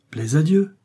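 transported, voice lifting at the end; carried away. A narrator's reading. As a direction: cry it out with rising fervour. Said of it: "s'écria Aramis transporté".

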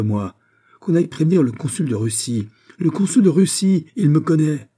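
high, loud, outraged ranting; indignant protest. Acted. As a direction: protest wildly while being held back, building in pitch. Restrained man protests to the judge. (protestation indignée, aiguë et forte)

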